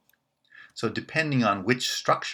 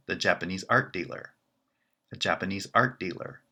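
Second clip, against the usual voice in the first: art carries the pitch, Japanese art dealer quick; stress on art; faster than usual. Shown as a demonstration. Teacher demonstrating a dealer from Japan. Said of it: "That's an art dealer who is from Japan".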